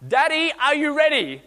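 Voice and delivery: put-on high, loud child's call, slowed; a child calling from the pool edge; little girl calling. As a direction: call out like an eager four-year-old. Voicing little daughter calling out.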